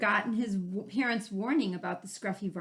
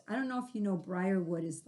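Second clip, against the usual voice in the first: usual speaking voice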